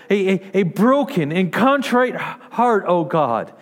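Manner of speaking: preacher reading Psalm fifty-one to the congregation, earnest and rising; scripture reading. Acted. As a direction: pray the psalm aloud, building. Reads Psalm fifty-one aloud.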